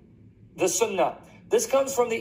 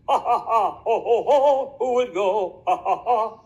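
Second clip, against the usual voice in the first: put-on ha-ha, ho-ho laughter; hammed-up fake guffaw in syllables; mock belly laugh. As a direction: laugh it out theatrically, mockingly. Mimics a laughing Lord mockingly.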